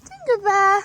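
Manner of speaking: loud high call across a field. Real, not acted. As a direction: call the horse from afar. Calling horse across field.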